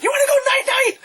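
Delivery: very high comic squeal; squeaky mock threat, verging on laughter; Kevin Hart. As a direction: imitate a comedian's high-pitched taunt. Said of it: "Paul just went".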